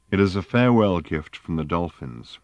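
usual speaking voice

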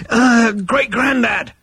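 high, slow, drawn-out appeal; desperate bid for an elder's attention; exasperated call. A narrator's reading. Zaphod desperately calls for attention.

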